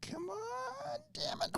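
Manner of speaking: frustrated whisper. Real, not acted. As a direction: mutter it under your breath. Frustrated under breath.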